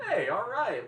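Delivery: bright loud pitch jump of delight; excited cheer. Real, not acted. Streamer cheering a viewer from Atlanta, excited. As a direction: greet the news with a happy whoop.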